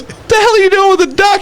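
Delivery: quick, high incredulous question; retold disbelief. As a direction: act out an exasperated dealer. Dealer retold, baffled by duck.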